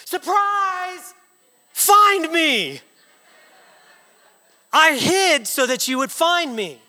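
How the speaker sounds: put-on high, excited kids jumping out; high-pitched acted child's excitement; excited children. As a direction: imitate gleeful children calling from hiding. Voices children jumping out of hiding.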